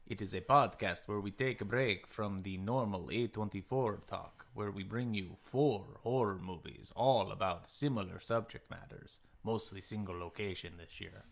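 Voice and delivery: slow, measured announcer delivery with swooping tune; show host intro. Acted. Host welcoming listeners to the horror season, theatrical intro. Opens the podcast episode.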